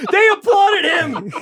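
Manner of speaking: slow, high, loud yell; incredulous shout. Real, not acted. Co-host mocking the applause story, incredulous shouting. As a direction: holler it in comic disbelief. Shouting in heated comic argument.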